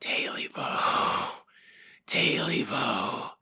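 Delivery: soft, drawn-out ghostly whisper; eerie whisper. Acted. Creature back in the hermit's house at night, eerie whisper. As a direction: stretch each word in a haunting whisper.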